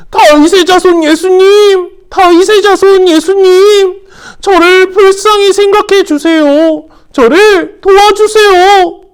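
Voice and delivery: high, loud, slow desperate call; crying out loudly. Acted. Blind Bartimaeus crying out to Jesus, loud desperate plea. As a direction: shout out to Jesus, pleading. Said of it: "큰 소리로 외쳤어요".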